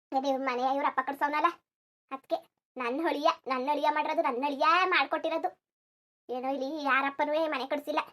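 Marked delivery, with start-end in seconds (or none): none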